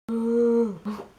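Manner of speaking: long, loud voiced gasp; sudden loud gasp. Real, not acted. Poet finishing her piece, sudden weird loud gasp. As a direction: let out an odd, drawn-out "ooh". Odd sound after poem ends, then apologises.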